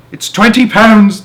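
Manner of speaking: high, loud, slow yell; excited yell. A narrator's reading. Young man on the street yelling up excitedly. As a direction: yell it out with delight. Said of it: "the man yelled".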